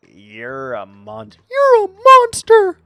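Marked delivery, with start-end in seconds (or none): 1.5-2.8 very high, loud squeaky cookie shriek; Gingerbread Man. Retold or imitated. Streamer quotes Shrek's Gingerbread Man, squeaky shriek. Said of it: "Not the gumdrop buttons!".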